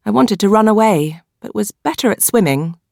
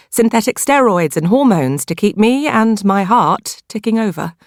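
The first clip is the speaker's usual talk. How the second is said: plain reading voice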